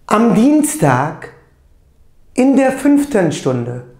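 slow, loud, careful German model sentences; teacher reading German aloud, deliberate and loud; pronunciation drill. Shown as a demonstration. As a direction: say each German phrase clearly for the class to repeat. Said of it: "doğru telaffuzuyla tekrarlayalım".

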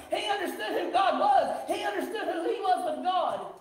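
rising pulpit fervour; preaching, building. Real, not acted. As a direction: build momentum phrase by phrase. Preaching builds to full force.

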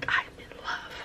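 breathy in-love whisper. Real, not acted. Vlogger whispering over her paint, awed whisper. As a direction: whisper it in awe.

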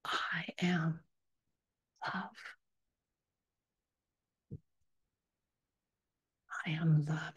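soft whisper, almost breath; whispered affirmation. Acted. Guide whispering love to all humanity, soft whisper. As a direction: hush it like a secret blessing.

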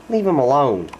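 ordinary talk

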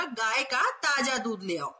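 गाय का ताजा दूध ले आओ read slowly at normal level; slow careful reading. Shown as a demonstration. Teacher reading the corrected sentence slowly to children. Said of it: "सही वाक्य क्या बनेगा".

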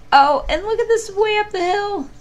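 high, loud exclamation at the picture; sudden high-pitched excitement; excited. Real, not acted. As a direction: point it out with delight.

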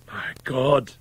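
high, loud exclamation; anguished cry. A narrator's reading. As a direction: cry out in horror. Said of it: "he cried".

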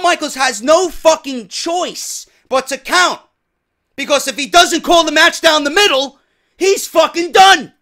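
loud, high, worked-up rant; fired-up shout over the stakes. Real, not acted. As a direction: build to a shout about the stakes. Ranting about the stakes.

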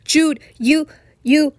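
shout, then break off mid-sentence. A narrator's reading. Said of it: "he too is shouting".

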